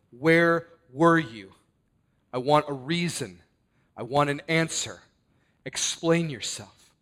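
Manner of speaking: loud, accusing demand for answers. Acted. Grieving sister demands Jesus explain, loud accusing.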